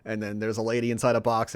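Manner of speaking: ordinary delivery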